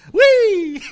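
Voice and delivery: high, loud drawn-out squeal; hammed-up joyful whoop with a laugh; mock glee. Preacher mocking joy at being beheaded, gleeful squeal.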